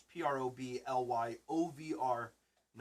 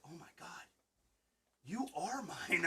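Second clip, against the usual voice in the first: awed whisper; 4 dB quieter than usual. Retold or imitated. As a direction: breathe it softly in wonder. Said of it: "I was just like".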